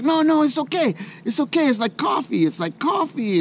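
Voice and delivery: calm him down in a high friendly voice; reassuring locals. Retold or imitated. Locals reassure the nervous visitor, high and soothing. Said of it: "And they're like".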